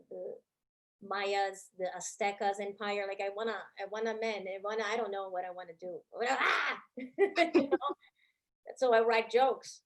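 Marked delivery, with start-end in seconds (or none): none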